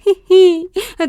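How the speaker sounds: high, loud sarcastic laugh; brief put-on scoffing laugh; mocking laugh. A narrator's reading. Grandmother mocks the excuse.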